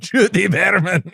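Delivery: high, slow mock Scottish; exaggerated Scottish burr; Scottish accent. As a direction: roll out the name in hammed-up Scottish. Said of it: "Time Magazine is a Scottish production".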